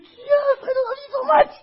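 very high, loud squeal of love; hammed-up ecstatic affection; gushing adoration. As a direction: squeal it like an overjoyed doting parent. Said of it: "he's just like".

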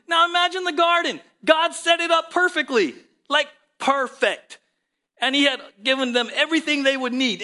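high, loud impassioned preaching; preaching at full force. Acted. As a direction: lift the room, preach it out. Preaching climax on the garden.

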